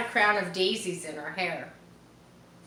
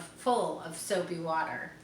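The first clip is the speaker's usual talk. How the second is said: ordinary delivery, nothing marked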